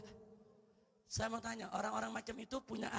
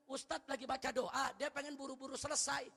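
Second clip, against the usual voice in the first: normal talking voice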